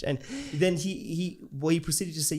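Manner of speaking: usual speaking voice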